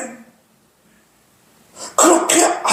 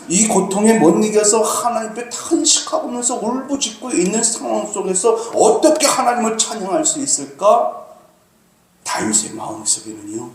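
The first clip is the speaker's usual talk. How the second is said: ordinary delivery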